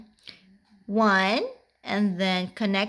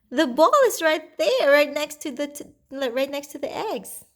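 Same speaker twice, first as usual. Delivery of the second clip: put-on reading to a toddler; 5 semitones higher than usual. Pointing out ball to toddler.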